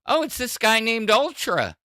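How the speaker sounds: high, loud acted reply; retold group answer. Discord members telling the streamer who did it, high loud reply. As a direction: call it up high, imitating a group. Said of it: "And we're like".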